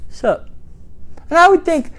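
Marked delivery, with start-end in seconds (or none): 0.1-0.5 put-on soft, clipped, vowel-less teenager; mock teenage grunt. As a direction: mumble it like a sulky teen. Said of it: "He would come in the house and say".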